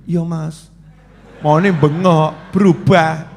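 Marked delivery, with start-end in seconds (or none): 0.1-0.7 much softer, timid yes; meek reply. Retold or imitated. Cowed wife answering her husband, meek.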